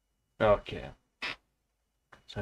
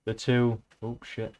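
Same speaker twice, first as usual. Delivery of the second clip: ordinary talk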